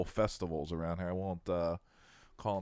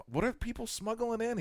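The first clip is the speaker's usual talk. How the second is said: mock indignant. Mock indignant, pitch shoots up.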